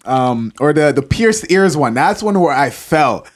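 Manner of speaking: normal talking voice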